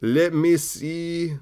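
aged game-show host, slow and high; Steve Harvey. Shown as a demonstration. Comic imagining the host in twenty years, drawn-out impression. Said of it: "It's like Steve Harvey in twenty years".

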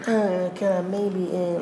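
mock shy suitor asking permission; timid, hesitant man. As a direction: play a guy too scared to ask. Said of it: "You talk like you wanna".

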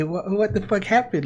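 ordinary talk, nothing marked

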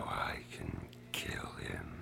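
menacing villain whisper; unhinged villain. Acted. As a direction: breathe the threat like a madman. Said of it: "Exactly the maniacal laugh I'm looking for".

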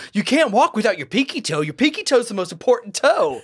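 high, earnest deadpan lecture, hammed-up; mock Vigilante. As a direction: lecture the toe rule with mock gravity. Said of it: "He's like".

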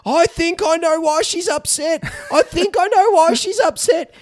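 put-on high, slow little boy's sheepish confession; a dad voicing his son, high and drawn out. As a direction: play the guilty son owning up. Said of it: "Ruben's there at the doorway going".

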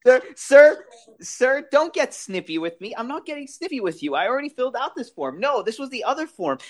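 high, rapid-fire bickering at a counter; mock DMV argument. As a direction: act out both sides of a snippy back-and-forth, pitched high and rushed. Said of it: "and then he's like".

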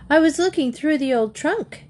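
lightly voiced young girl explaining; girl's voice. Katy explaining to Dad.

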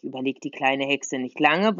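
ordinary delivery

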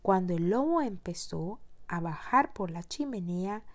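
usual speaking voice

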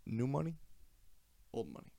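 dad's quiet deadpan verdict. Retold or imitated. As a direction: drop it softly and dry.

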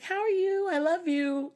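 high, loud, bubbly greeting; super friendly, bubbly. Retold or imitated. Friend greeting her on the phone, super friendly. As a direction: imitate a gushing, friendly caller. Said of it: "very super friendly, bubbly voice".

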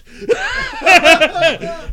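high loud burst of laughter. Real, not acted. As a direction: laugh out hard.

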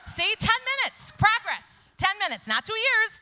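delighted mock bragging; excited boast. Real, not acted. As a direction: crow about it playfully. Laughing at her own progress.

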